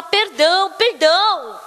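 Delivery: acted-out imploring apology; begging forgiveness. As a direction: beg for pardon, drawing it out. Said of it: "ficou implorando".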